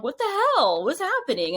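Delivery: high, squeaky mock disbelief; mock baffled outburst. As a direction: pitch it way up in play-acted confusion. Retelling own baffled annoyance.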